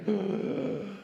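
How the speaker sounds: put-on a child's sharp gasp of wonder; amazed gasp. Son at gem mine gasps, amazed gasp. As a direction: gasp like a boy seeing treasure.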